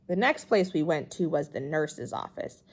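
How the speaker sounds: ordinary delivery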